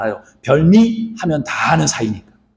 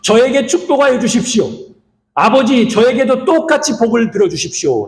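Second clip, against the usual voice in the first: higher, a hint of a tearful cry; crying plea; 7 semitones higher than usual. Esau crying out to his father, mildly higher tearful plea. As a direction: beg with a mild tearful rise. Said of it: "울면서 소리치며".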